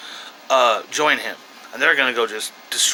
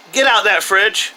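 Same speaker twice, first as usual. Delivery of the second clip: raised, higher parent's order; calling out sternly; 5 semitones higher, 3 dB louder than usual. Real, not acted. Reviewer breaking off to order kid from fridge, calling out sternly. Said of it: "gotta go yell at this kid".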